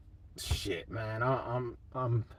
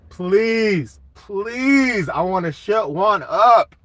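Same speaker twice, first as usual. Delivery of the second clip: higher, louder, slow begging please; pleading; 6 semitones higher, 6 dB louder, much slower than usual. Real, not acted. Streamer begging fans for a Niners win, loud pleading. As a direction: beg for it, stretching each please.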